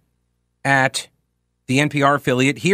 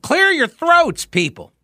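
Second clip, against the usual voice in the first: mock plea; slower than usual. Real, not acted. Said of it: "It's like".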